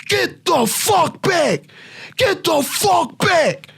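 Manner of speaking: furious shout. Retold or imitated. Soldier ordering the Iraqi crowd away from the convoy, yelling. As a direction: bellow them back from the vehicle.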